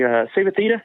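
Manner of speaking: high, offhand coach voice; casual coach. Retold or imitated. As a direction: toss out the name lightly. Coach naming the faster girl.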